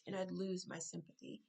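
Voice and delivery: ordinary delivery, nothing marked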